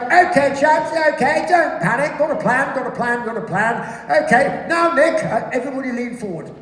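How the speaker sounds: high, loud, brisk officer voice; acted pilot taking charge; unflappable pilot. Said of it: "And he's like".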